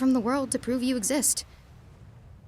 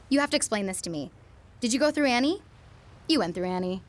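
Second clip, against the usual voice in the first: ordinary talk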